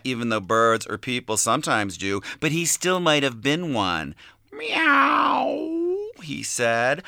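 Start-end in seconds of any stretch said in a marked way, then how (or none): 4.5-6.1 high, drawn-out cat meow; long rising meow. A narrator's reading. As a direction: mimic a cat, pitched way up. Cat imitation in notebook reading.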